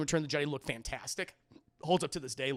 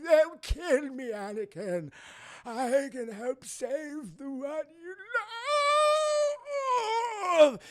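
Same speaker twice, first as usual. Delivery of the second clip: high, slow, overwrought plea; hammy villain pleading; 9 semitones higher, 4 dB louder, slower than usual. Retold or imitated. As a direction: beg in a put-on old villain voice. Palpatine pleading with Anakin.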